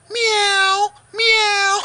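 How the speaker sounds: high, drawn-out kitty meow; cat meow. Acted. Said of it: "He goes".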